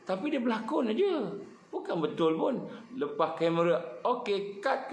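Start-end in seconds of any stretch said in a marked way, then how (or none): 4.0-4.9 director calling cut. Retold or imitated. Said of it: "kata pengarah".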